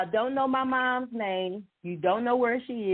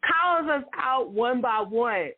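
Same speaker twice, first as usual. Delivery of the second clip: usual speaking voice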